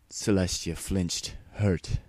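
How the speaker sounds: plain reading voice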